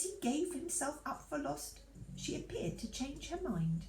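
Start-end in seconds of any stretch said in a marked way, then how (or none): none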